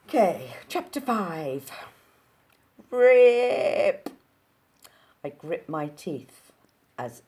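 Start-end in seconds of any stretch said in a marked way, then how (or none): 2.9-4.1 loud high mock rip noise; sudden loud rip; ripping sound. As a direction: belt out the tearing sound. Tearing fabric sound effect.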